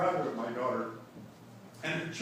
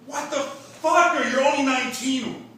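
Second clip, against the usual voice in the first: incredulous outburst; 10 semitones higher, 11 dB louder than usual. Retold or imitated. Father reacting to his teenage daughter's marriage, loud incredulous outburst. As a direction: shout the shock at her age. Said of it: "Before I could reply with a".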